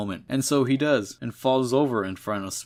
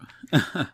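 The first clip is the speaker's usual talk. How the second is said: disbelieving laugh; 4 semitones higher than usual. Real, not acted. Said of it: "holy shit".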